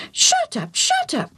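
very high parrot screech; screaming parrot. A narrator's reading. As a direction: squawk it like a mimicking bird. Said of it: "the parrot said again".

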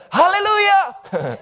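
high drawn-out shout of praise; old father erupts with joy; loud shout. Acted. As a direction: let it ring out, slow and joyful. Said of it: "yells with a loud voice again".

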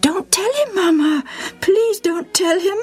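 put-on high, urgent girlish begging; pleading. A narrator's reading. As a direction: beg Mama desperately. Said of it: "Milcah pleaded".

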